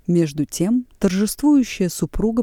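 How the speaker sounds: ordinary delivery, nothing marked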